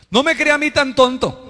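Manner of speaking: high, emphatic offended retort; hammed-up affronted protest; mock indignant husband. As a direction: throw the apology back in put-on indignation. Offended spouse rejects apology, acted.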